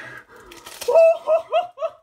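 shrieking laugh; high screech. Real, not acted. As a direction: squeal at the top of the voice. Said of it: "What was that screech?".

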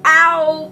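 sharp high "Ow!" of real pain; sudden pained yelp. Real, not acted. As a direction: yelp as the paper stings you. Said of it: "Paper cut on my nose".

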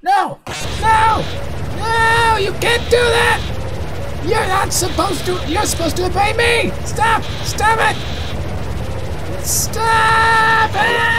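frantic high-pitched yelling as control slips away; panicked screaming. Acted. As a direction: scream for them to stop, stretching the last cry. Villain overwhelmed by rebelling souls.

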